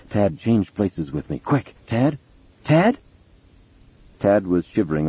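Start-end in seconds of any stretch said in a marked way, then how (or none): 0.1-3.0 urgent plea climbing high; feverish urgency. A narrator's reading. Mother urging her son to change places, feverish and climbing. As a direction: build from hushed urgency to a high, pressing cry. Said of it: "she whispered feverishly".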